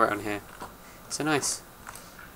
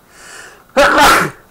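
loud real sneeze; sneezing; 17 dB louder than usual. Real, not acted. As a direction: sneeze hard. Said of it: "I'm gonna sneeze again".

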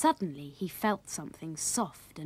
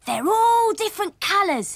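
high-pitched, louder storybook excitement; excited child character; 13 semitones higher, 7 dB louder than usual. A narrator's reading. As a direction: voice a thrilled little boy, pitched way up. Sammy's excited quote in story reading.

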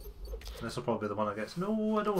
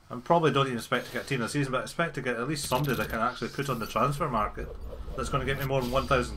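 fast rant; faster than usual. Real, not acted.

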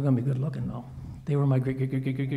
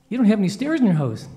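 loud, higher-pitched yell from below; calling up; 5 semitones higher, 7 dB louder than usual. Acted. Friends yelling up about the missing stairs, loud calling. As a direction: holler it up to a high window. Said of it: "come back out and yell up".

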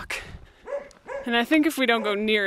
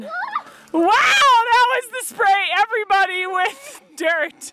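high, loud, amazed play-by-play; excited mock commentary; 11 semitones higher, 4 dB louder, slower than usual. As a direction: shoot up high like a wowed commentator. Mock commentator reacts to dirt spray.

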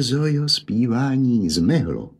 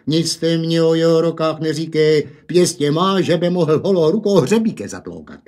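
louder, fast angry outburst; sputtering with anger; 4 semitones higher, 5 dB louder than usual. Acted. Enraged father sputtering at the mother about the boy. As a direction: spit the words out in fury. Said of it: "rozkohotěný a zlosťou jen prskal".

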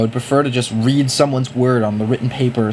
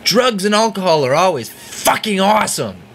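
mock advice; 7 semitones higher than usual.

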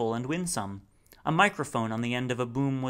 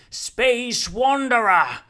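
long booming call, much higher and louder; slow, drawn-out summons over a crowd; 11 semitones higher, 10 dB louder, much slower than usual. Acted. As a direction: hail him from a treetop. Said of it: "called Rumford again".

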